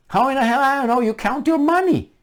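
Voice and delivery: high, disbelieving question; can't believe this logic; incredulous. Real, not acted. Ranting at pundits, high and incredulous.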